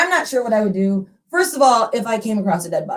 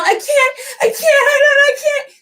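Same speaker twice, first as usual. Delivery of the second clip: much higher, crying out in panic; frantic crying woman; 4 dB louder than usual. Acted. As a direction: sob the words out, frantic and breathless. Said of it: "She's frantic, she's crazy, she's wild".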